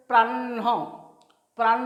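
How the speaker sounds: "প্রাণ" with held n and an added breath of ho; n before h. Shown as a demonstration. Teacher introducing the next word, প্রাণ as "prann-ho". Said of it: "উচ্চারণ হবে".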